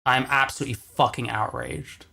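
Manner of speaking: usual speaking voice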